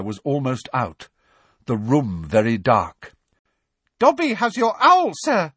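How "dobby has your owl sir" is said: very high, squeaky elf voice. A narrator's reading. Dobby greeting Harry in the dark, high and squeaky. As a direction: squeak it out like a tiny house elf. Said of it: "said a squeaky voice".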